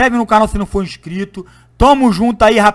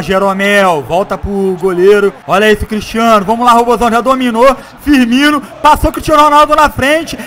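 lively football match commentary; play-by-play commentary; 4 semitones higher, 6 dB louder than usual. Acted. Live play-by-play of own match.

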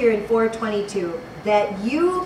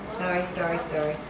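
low, soft repeated apology; muted apology; 7 semitones lower, 8 dB quieter, faster than usual. Real, not acted. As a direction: say it under your breath, correcting yourself. Apologising for the wrong book.

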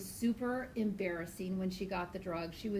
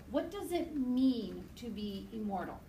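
normal talking voice

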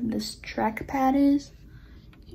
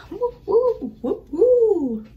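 high, loud gleeful woo; excited cheering; 8 semitones higher, 9 dB louder, slower than usual. Real, not acted. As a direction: cheer with laughing joy. Dancing in excitement.

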